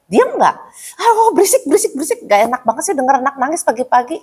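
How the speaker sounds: acted exasperated scolding; irritated parent.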